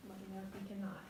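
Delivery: soft whispered check for hearing; whispered test question. Shown as a demonstration. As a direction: whisper it so he can barely hear. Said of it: "Now I'm going to do the whisper test".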